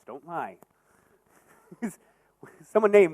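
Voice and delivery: ordinary talk, nothing marked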